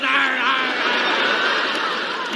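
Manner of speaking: imitated dog growling; comic growling dog noise. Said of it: "the dog growls".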